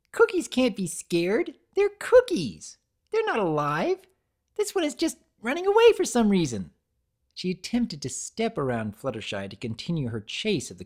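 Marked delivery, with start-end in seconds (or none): 0.1-6.7 very high, loud bubbly explaining; Pinkie voice. A narrator's reading. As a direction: breezily dismiss it.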